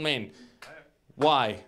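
ordinary delivery

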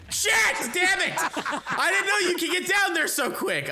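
loud laughing curse; frustrated shout. Real, not acted. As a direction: yell in game frustration. Game loss outburst.

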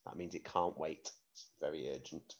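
usual speaking voice

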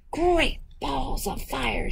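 high, slow goose exclamation; goose's cry. Acted. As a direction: cry out as a storybook goose, drawn out. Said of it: "she cried".

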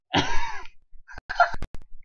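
very high squealing laugh. Real, not acted. Laughing at own story.

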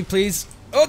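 ordinary talk, nothing marked

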